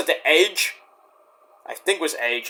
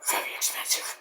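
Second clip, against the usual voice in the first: whispered aside; 13 dB quieter than usual. Real, not acted. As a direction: drop into a whisper. Same whispered aside on cost.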